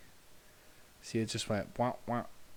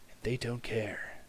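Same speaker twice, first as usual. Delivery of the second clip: whisper; 3 dB quieter, slower than usual. Real, not acted. Whispered remark about neighbors.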